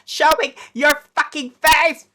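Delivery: real scream. Real, not acted. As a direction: yell it at the screen. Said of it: "I'm screaming".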